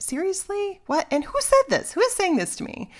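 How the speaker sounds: very high, breathless incredulous questions; who on earth said that; bewildered panic. Retold or imitated.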